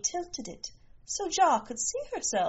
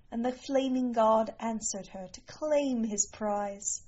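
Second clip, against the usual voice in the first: plain reading voice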